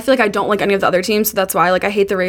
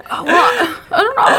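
high-pitched, flustered mock reply; retold confusion; 12 semitones higher, slower than usual. Replying to coworker about cake orders, high mock confusion. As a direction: voice shoots up, helplessly unsure.

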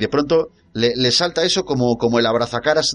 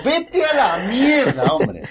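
mocking dismissal; 12 semitones higher, much slower than usual. Mocking a film line.

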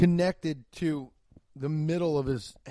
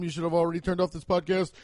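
usual speaking voice

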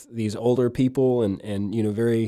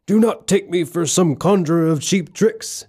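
high, loud wizard rebuke, the first words slow; Gandalf impression; 8 semitones higher, 4 dB louder, slower than usual. Retelling Gandalf scolding Bilbo, hammed-up wizard voice. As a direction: boom it out like an offended old wizard. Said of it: "And then Gandalf says".

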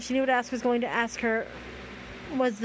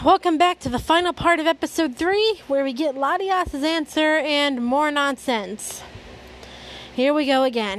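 louder host welcome; show intro; 2 semitones higher, 4 dB louder than usual. Acted. Host welcoming listeners back to episode three, show intro. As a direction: announce the new part with extra volume. Opens the next part to listeners.

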